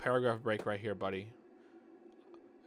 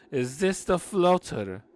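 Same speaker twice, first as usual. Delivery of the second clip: creepy Bond villain; 5 semitones higher, 6 dB louder, slower than usual. Acted. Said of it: "creepy James Bond villain".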